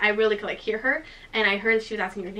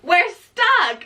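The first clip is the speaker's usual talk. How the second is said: loud, high mock holler; yelling to each other; 7 semitones higher, 6 dB louder than usual. Friend reports up from below, acted yell. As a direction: imitate shouting news up to a friend. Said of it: "We have to, like, yell at each other".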